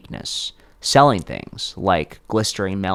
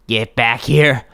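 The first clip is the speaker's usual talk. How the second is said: loud angry shout from above; screamed order; 4 semitones higher, 6 dB louder than usual. Acted. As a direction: bellow it after the runaway boy. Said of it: "Father Tufts screamed".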